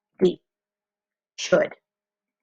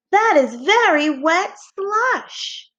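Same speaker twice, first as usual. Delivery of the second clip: higher and slow, full of excitement; exclamation-point excitement; excited; 8 semitones higher, slower than usual. Shown as a demonstration. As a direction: sell it like an exclamation. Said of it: "I could have used an exclamation point".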